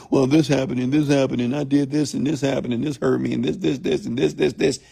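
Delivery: run-on litany of grievances, lightly mimicked; mock complainer. Coach mimicking people who retell their past, mock run-on complaining. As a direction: rattle on in one unbroken stream, mildly mocking.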